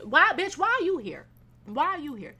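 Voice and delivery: exasperated. Retold or imitated. Ranting at the imagined loud woman, exasperated. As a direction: snap at her why she's here.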